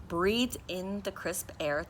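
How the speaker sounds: ordinary delivery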